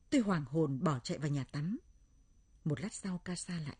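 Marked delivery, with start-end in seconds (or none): none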